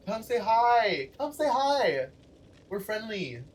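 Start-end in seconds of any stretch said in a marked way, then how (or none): 0.1-1.1 higher, slow sing-song invitation; a lilting come say hi; friendly invitation. Acted. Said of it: "Guys, if you see me". 1.2-2.1 much higher, playful sing-song; sing-song repeat. Acted. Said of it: "Guys, if you see me".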